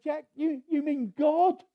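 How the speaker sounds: high, loud incredulous questions; can't believe it's God; stunned disbelief; astonished. Retold or imitated. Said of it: "The Lord said".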